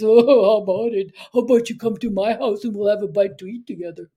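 troll voice. Acted. Troll voice inviting boy home.

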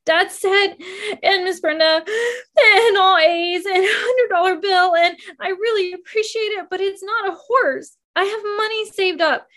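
put-on girl pleading with her parents for a horse, tearful; tearful spilling. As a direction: spill it all out while still crying. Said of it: "shaking on the inhale I spilled".

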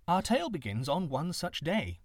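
usual speaking voice

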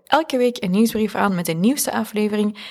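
loud, upbeat promo read. Acted. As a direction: pitch the newsletter energetically. Ad break read to listeners. (luide reclameboodschap)

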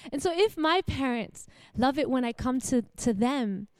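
ordinary talk

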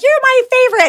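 very high squeal of adoration; gushing fan. Retold or imitated. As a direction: squeal it like a fangirl.

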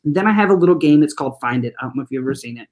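usual speaking voice

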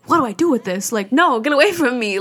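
mock recoil.

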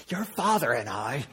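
strained reproachful mother; in-your-face scolding. Acted. Mother getting into her son's face, strained scolding. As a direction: confront the child head-on. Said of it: "She's getting into Jesus' face".

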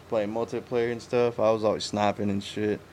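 ordinary delivery

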